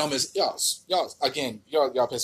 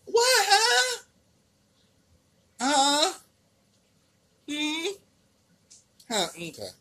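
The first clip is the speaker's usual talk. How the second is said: much higher, baffled huh; mock confusion; 12 semitones higher, much slower than usual. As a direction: play dumb, baffled.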